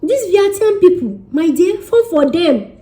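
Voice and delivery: put-on a light South African accent; nasal South African imitation. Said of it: "talk with their nose".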